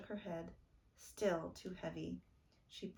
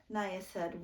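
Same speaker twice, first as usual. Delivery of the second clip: usual speaking voice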